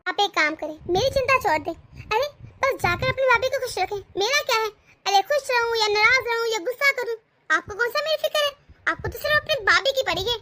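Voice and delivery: wife taunting husband about his sister-in-law, bitter. Acted. Wife's jealous taunt.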